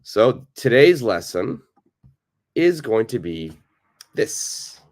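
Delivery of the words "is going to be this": ordinary talk, nothing marked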